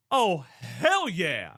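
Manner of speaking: slow drawn-out oh hell yeah; real thrill. Real, not acted. Streamer hears his team won, slow thrilled drawl. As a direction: savour the win, stretching every word.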